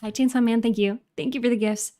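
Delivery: usual speaking voice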